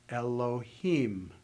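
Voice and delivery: Elohim, slowly, stress on the final him; accent on the last syllable. Shown as a demonstration. Teacher models Elohim for students, slow and careful. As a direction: say Elohim slowly, landing on the end. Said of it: "the accent ... at the end of the third word".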